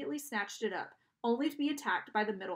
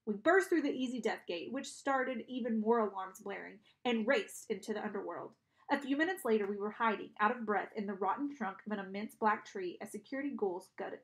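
plain reading voice

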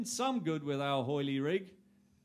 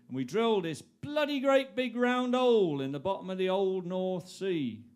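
ordinary talk, nothing marked

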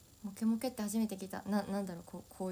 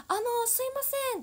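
much higher, louder, fast earnest call; a teammate calling out loudly and much higher in pitch; 6 dB louder, faster than usual. Retold or imitated. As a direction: call out politely with a big voice. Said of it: "大きい声で".

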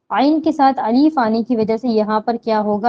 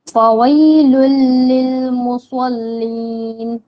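slow, drawn-out Quranic chant; Quran recitation; much slower than usual. Shown as a demonstration. Teacher reciting the verse for students, slow chant. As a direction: recite each word slowly, holding the long vowels.

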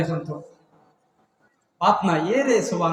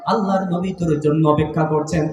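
usual speaking voice

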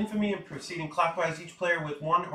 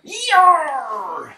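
pirate yell; 12 semitones higher, 10 dB louder than usual. Acted. Pirate captain roleplay cheer.

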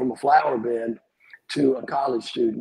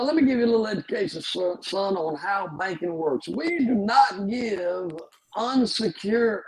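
imitating a banker, much higher, slowing to a crawl at the end; lecturing banker; 8 semitones higher than usual. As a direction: lay out the rules of banking like a condescending loan officer. Banker lecturing a broke kid.

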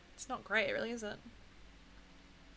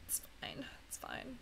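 whispered, defeated it's fine; resigned whisper; 3 semitones higher, 17 dB quieter than usual. Real, not acted. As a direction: breathe it out in defeat. Losing round, resigned.

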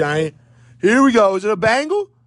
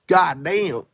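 normal talking voice

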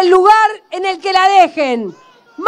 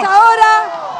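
rousing rally call; booming balcony speech; 3 semitones higher than usual. Real, not acted. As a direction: call it out to the crowd. Rally chant to the crowd.

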